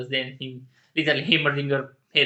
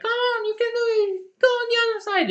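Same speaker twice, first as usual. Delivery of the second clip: high mock scream of encouragement; imitating Jinx screaming; 4 dB louder, faster than usual. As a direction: yell her on in a much higher squeal. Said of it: "Jinx is literally screaming".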